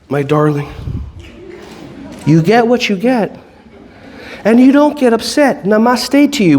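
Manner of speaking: hammed-up serene yogi parent saying the rule; mock-sweet parent. Parent soothing a kid wanting computer time, mock-serene. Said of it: "Do you say it like this?".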